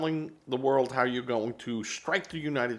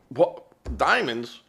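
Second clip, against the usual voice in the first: louder, higher mock outrage; mocking Democrats; 7 semitones higher, 6 dB louder than usual. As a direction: mimic a whining opponent, hammed-up. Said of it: "you'd have Democrats going".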